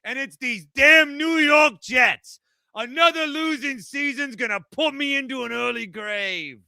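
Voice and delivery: put-on New York accent. Mock New York Jets caller.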